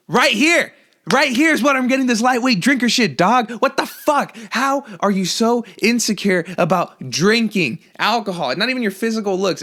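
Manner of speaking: high, loud, indignant yelling at a friend; mock angry rant. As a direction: tear into him as if offended.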